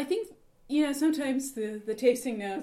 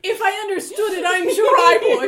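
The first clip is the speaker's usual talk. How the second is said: laughing hard; 8 semitones higher, 13 dB louder than usual. Real, not acted. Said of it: "a fabulous rendition".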